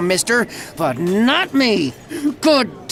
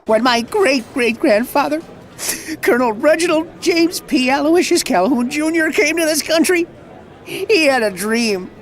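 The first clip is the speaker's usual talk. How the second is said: tearful; 5 semitones higher than usual. Acted. Tearful ancestor speech.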